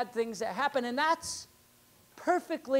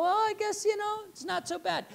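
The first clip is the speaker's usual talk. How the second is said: acted high-pitched, hedging concession; grudging backtrack; 11 semitones higher than usual. What we go all of a sudden, in a high, grudging voice. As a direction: back down lightly, pitched high.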